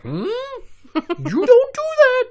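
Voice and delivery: high, slow hammed-up innocence; mock innocent. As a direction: play dumb in a silly squeaky falsetto. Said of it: "I was like".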